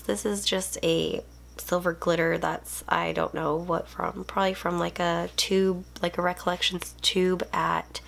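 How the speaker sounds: ordinary delivery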